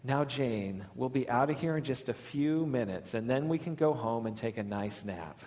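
hushed, even-tempered comfort; calm, patient mom. Retold or imitated. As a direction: reassure quietly and patiently. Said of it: "as calm and as patient".

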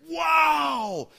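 high, loud burst of surprise; surprised wow. Retold or imitated. Cody reacts to his leader's confession, loud surprise. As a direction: caught off guard, delighted.

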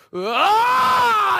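loud, high, drawn-out roar; sudden frustrated yell. Retold or imitated. Man snapping at a pushy street promoter, sudden roaring yell. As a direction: let pent-up frustration burst out in a long "Ahhh". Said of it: "I just, like, yell just like that".

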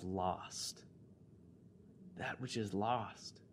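low, soft, reverent delivery; hushed, tender pronouncement; solemn scripture quote. Real, not acted. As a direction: let the words sink in quietly. Quotes Jesus' words solemnly.

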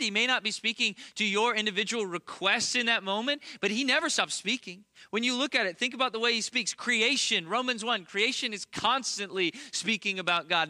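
high, loud fervent sermon voice; impassioned pulpit proclamation; preaching at full force. Real, not acted. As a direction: build it up like a preacher on fire.

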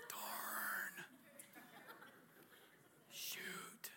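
very soft unvoiced mutter; whispered swear. Real, not acted. Preacher swapping a swear word, whispered. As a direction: breathe it as a tame curse.

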